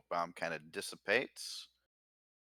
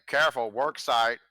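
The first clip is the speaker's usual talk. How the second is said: slow, loud mechanical announcement; robot voice; 5 semitones higher, 8 dB louder than usual. Acted. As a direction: intone it like a machine warning. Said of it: "It's like".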